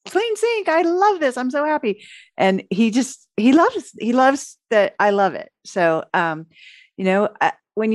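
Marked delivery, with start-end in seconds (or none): 0.1-2.0 much higher, bright praise for the clean sink; thrilled wife admiring the sink; delighted gushing. Retold or imitated. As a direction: gush over it to please him. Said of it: "And I'm like".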